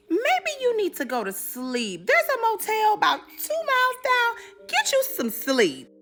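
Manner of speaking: slow, put-on voice; placating man voice. Navy man suggesting she rest, slow and soothing. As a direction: talk her down slowly.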